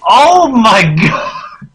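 high, loud laughing exclamation; laughing outcry. Real, not acted. To co-host about the title, a loud laughing cry. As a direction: burst out laughing in disbelief.

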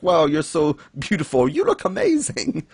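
mock gushing, climbing very high; hammed-up starstruck praise; gushing admiration. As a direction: swoon over them, squeaking at the end. Two characters admiring each other.

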